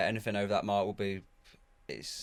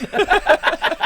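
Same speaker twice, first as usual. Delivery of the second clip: loud high-pitched laugh; high burst of laughter; 13 dB louder than usual. Real, not acted. Laughing at teasing.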